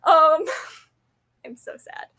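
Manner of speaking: giggling through the words, half crying with laughter; laughing at herself. Real, not acted. Vlogger cracking herself up over her own phrase, giggling. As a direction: crack up at your own joke. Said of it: "Crack myself up".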